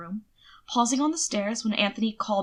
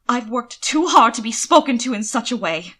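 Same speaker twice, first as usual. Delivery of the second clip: rising temper, louder and pressing; angry; 3 semitones higher, 7 dB louder than usual. A narrator's reading. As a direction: let the anger boil up as he says it. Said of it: "feeling his temper rise again".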